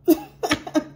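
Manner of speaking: high bright laugh bursting out; sudden amused laugh; burst of laughter. Real, not acted. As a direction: laugh out loud at the thought. Laughing at imagined gossip.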